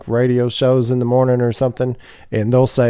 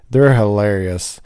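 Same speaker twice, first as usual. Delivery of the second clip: normal talking voice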